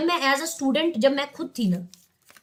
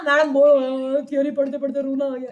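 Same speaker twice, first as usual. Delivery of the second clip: teasing imitation of a whiny bored student; mock complain like a tired pupil. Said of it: "ऐसा तो नहीं हो रहा?". (बोर छात्र की मज़ाकिया नकल)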